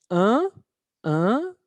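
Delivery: "un" as a nasal vowel, no n sounded; French nasal sound. Shown as a demonstration. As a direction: model "un" slowly for learners. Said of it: "muy importante este sonido".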